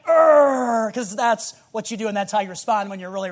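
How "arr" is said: short, loud mock growl; loud growl of pretend fury; angry growl. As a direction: burst into a hammed-up growl. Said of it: "you growl".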